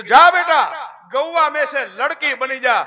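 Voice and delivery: loud, commanding transformation spell; saint casting spell. Acted. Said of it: "મંત્ર ભણ્યો અને ગા માથે પાણી છાંટી અને કીધું". (મંત્ર બોલતા સાધુનો ઊંચો આદેશ)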